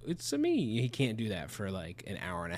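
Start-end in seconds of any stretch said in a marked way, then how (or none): 0.1-0.8 Mario catchphrase. Retold or imitated. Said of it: "he can do the".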